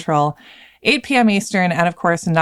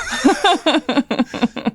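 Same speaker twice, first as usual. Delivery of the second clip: high laugh; 9 semitones higher than usual. Real, not acted. Laughs at the intro.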